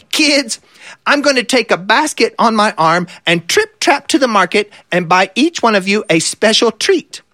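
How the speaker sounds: a mother goat giving orders before leaving; lightly voiced mother goat telling her kids to behave; mama goat. A narrator's reading.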